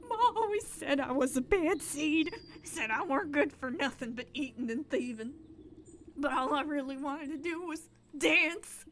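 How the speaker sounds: hammed-up sobbing bandit; blubbering bandit. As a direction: bawl it out like a heartbroken brute. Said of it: "great shuddering sobs".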